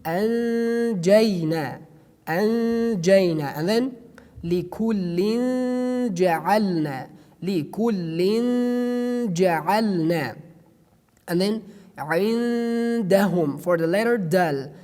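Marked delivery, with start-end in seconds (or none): 0.0-3.5 very slow anjayna with held nasal n; drawn-out ikhfa. Shown as a demonstration. Teacher reciting anjayna slowly. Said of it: "teacher demonstrating ikhfa slowly". 4.4-10.4 very slow likullin ja'alna, nasal n held; drawn-out ikhfa. Shown as a demonstration. Teacher reciting likullin ja'alna slowly. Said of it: "teacher demonstrating ikhfa slowly".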